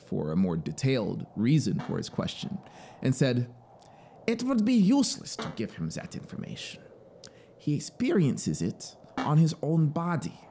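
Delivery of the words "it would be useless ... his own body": higher, matter-of-fact officer voice; officer, calmly dismissive. A narrator's reading. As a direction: brush the question aside. Officer answers the traveler.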